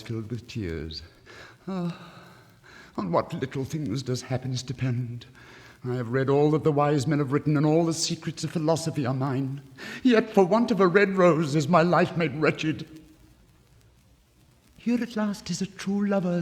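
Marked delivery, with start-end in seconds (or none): 1.7-12.9 put-on a despairing young student crying out his grief, louder; lovelorn student. Student's lament. 14.8-16.4 a lightly voiced, higher nightingale, moved; a hint of a rapt little bird. Nightingale speaks.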